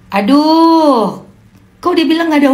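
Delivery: exasperated aduh. Real, not acted. Exasperated reaction.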